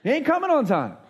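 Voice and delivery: loud, high-pitched indignant retort; exasperated Martha. Acted. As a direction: play a fed-up Martha snapping back, pitch jumping up. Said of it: "Martha would be like".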